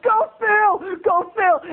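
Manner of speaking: very high-pitched excited chant; imitated cheering. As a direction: egg him on like excited kids. Kids cheering their friend on.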